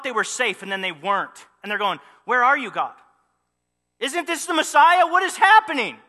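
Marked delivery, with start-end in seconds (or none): 2.3-6.0 loud, high pleading questions; desperate cry. Acted. Mary and Joseph crying out to God, loud and high. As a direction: call out to God in alarm.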